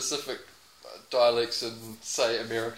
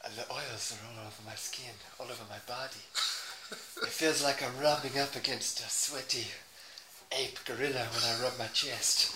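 very soft, low mock Spanish accent; 3 semitones lower, 11 dB quieter than usual. Player showing off his body, hushed Spanish accent. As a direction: murmur it in a put-on Spanish accent. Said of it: "Give me Spanish".

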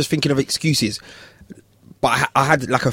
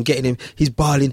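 normal talking voice

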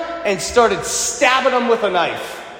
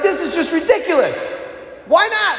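higher, incredulous whine; exasperated protest; 7 semitones higher than usual. Retold or imitated. Tourist arguing with the locals over lobster, exasperated and higher. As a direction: throw up your hands in disbelief.

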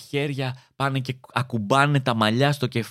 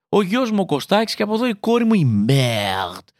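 proud parent; 5 semitones higher, 4 dB louder than usual. Pretend. Proud parent introducing children.

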